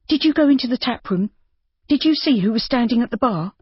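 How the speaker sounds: high, sharp questioning; furious. A narrator's reading. Judy rounding on Rob, furious and high. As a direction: round on him. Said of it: "She rounded on him furiously".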